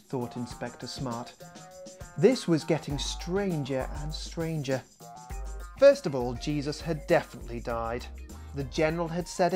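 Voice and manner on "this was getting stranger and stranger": usual speaking voice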